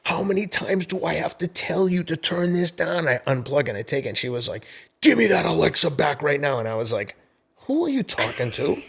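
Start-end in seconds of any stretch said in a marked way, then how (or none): 0.0-3.1 exasperated parent. Retold or imitated. Parent scolding teen over music. 5.0-6.6 put-on higher, louder bratty teen demand; mock-indignant teenage demand; imitating daughter's demand. Daughter demanding speaker back. 7.7-8.8 amused retort. Retold or imitated. Dad retorts to daughter, laughing.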